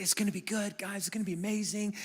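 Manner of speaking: Isaiah teasing the crowd in a hush; soft teasing hush. Retold or imitated. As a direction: lean in and tease them quietly.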